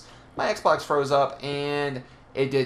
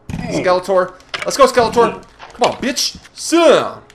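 loud, high, angry taunts at a skeleton; frustrated yelling; 8 semitones higher, 10 dB louder than usual. Real, not acted. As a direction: yell it out, really fed up. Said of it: "frustrated there".

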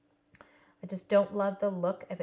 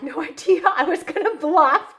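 laughing; 9 semitones higher, 11 dB louder than usual. Real, not acted. Laughing at own bluff in game.

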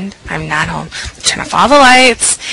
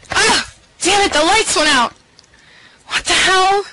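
much higher startled shout; high shocked outcry; 10 semitones higher than usual. Acted. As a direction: jump when the lights die. Lights go out suddenly.

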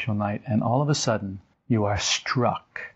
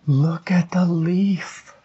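slow, awed whisper, higher; hushed wonder; 7 semitones higher, 4 dB louder, much slower than usual. Acted. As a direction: whisper it as if seeing it for the first time. Marvelling at a dead leaf.

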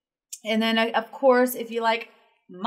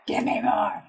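normal talking voice

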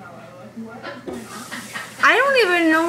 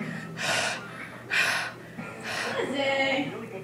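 heavy breathing; 11 dB quieter than usual. Retold or imitated. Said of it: "you're like".

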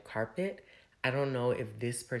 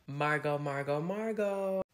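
sing-song call; 5 semitones higher than usual. Acted. Sing-song calling out a name.

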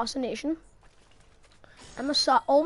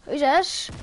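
ordinary talk, nothing marked

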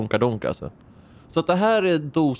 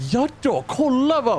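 excited; 12 semitones higher than usual. Real, not acted. Excited at the car.

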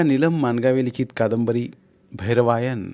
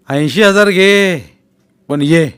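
louder and slow, pressing hard; insistent offer; 4 semitones higher, 9 dB louder, slower than usual. A narrator's reading. Kumar raises his offer to Vaibhav, slow insistent push. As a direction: lean in and insist.

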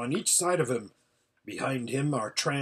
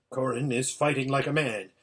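usual speaking voice

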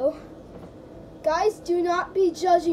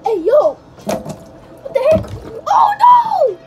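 excited shouting; 8 semitones higher, 10 dB louder, much slower than usual. Real, not acted.

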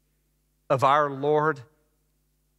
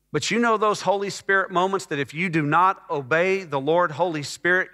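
usual speaking voice

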